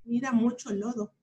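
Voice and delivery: ordinary delivery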